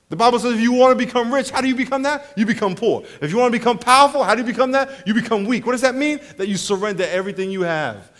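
ordinary talk

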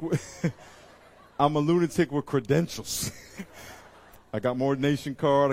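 laughing through the words. Real, not acted. Preacher joking about being a lunatic, laughing. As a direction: chuckle while you say it.

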